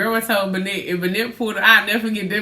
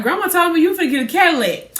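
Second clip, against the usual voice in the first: retold brother; 9 semitones higher, 5 dB louder, faster than usual. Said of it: "He was like".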